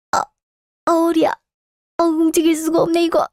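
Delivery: acted pained cry, unable to move; back pain groan. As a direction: wince through the words, stuck and hurting. Mother hurts back falling off chair.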